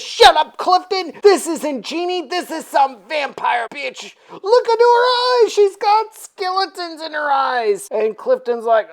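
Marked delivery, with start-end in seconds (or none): 0.0-7.9 high, loud, exasperated mock outburst; snap, then climb higher in alarm; snapping. Frank warning drunk Clifton about the vampire, hammed-up snapping. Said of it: "Frank snaps at Clifton".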